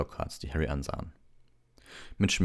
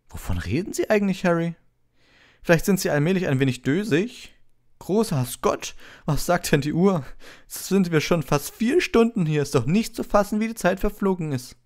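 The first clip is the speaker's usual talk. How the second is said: preen over your fan mail; Lockhart voice; 8 dB louder than usual. A narrator's reading. Lockhart boasts about a fan to Harry, high and pompous.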